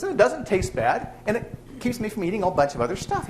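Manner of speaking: man explaining. Retold or imitated. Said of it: "He says".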